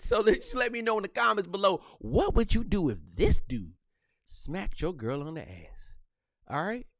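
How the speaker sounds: normal talking voice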